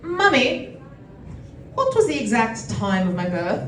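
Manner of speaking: put-on British daughter asking mum; British accent. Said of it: "I'm always British in this bit".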